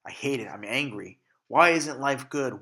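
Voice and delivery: ordinary talk, nothing marked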